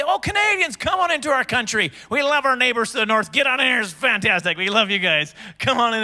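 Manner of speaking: high, gushing mock welcome; happy welcoming border guard. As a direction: greet them like beloved neighbors, hammed-up. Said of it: "it used to be happy fun time".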